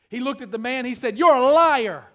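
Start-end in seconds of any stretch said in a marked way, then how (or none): 1.2-2.0 retold shout. Preacher confronts man in retold story.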